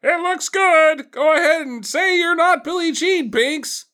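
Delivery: mock high-pitched reassuring voice. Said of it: "to be like".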